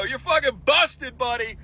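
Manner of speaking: high, slow taunting sing-song; mocking taunt. Taunting the scammer who just got caught, in a high, slow mocking sing-song. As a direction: gloat over the caught scammer. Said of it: "And I go".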